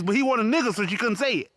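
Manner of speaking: ordinary delivery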